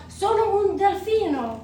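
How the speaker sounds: high, loud playful cry in Italian; joyful dolphin shout. A narrator's reading. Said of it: "I shout".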